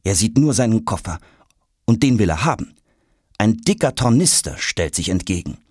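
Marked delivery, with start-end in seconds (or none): none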